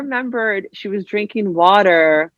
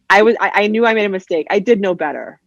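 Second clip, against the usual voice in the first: ordinary delivery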